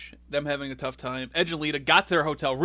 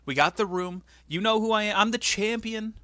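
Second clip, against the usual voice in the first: Edge impression; 7 semitones higher than usual. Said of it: "And here, I'm here with Lita".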